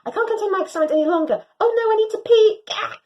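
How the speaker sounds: fast, high, loud babble; dog too thrilled to hold it; frantic excitement. Acted. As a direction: burst out in a rush.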